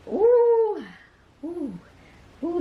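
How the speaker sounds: high loud ooh; real joyful squeal; delighted squeal. Real, not acted. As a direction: squeal at the sight. First sight of the cover.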